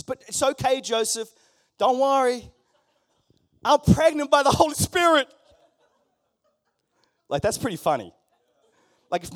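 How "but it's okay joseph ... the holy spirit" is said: high, loud and laughing cheerful reassurance; bright mock-sweet reassurance with a laugh; hammed-up cheerful Mary; chirpy Mary. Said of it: "She says".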